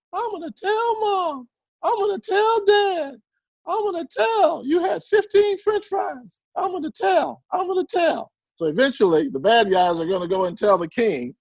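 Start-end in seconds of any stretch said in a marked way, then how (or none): 0.1-8.3 put-on high, singsong childish tattling; tattletale kid. Preacher mimics a childhood tattletale, high whiny voice. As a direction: whine it like a kid running to Mom. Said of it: "you always had a tattletale".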